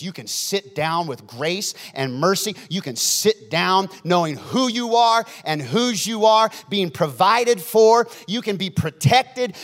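slow, loud, rising pulpit cadence; preaching at full force. Real, not acted. Preacher promising the congregation their position, building forcefully. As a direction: build phrase on phrase, punching key words.

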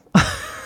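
a quick high burst of laughter; short laugh. Real, not acted. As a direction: laugh off the silly idea. Laughing at her own rhetorical question.

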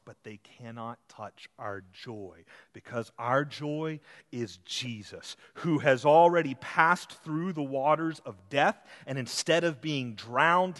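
ordinary delivery

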